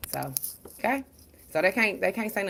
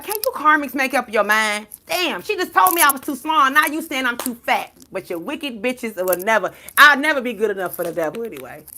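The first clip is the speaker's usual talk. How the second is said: retold exasperation; 5 semitones higher, 7 dB louder than usual. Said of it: "I was like".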